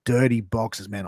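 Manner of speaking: ordinary delivery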